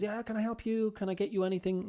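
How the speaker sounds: high-pitched shop assistant greeting. Acted. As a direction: offer help in a high, eager sing-song. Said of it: "somebody comes".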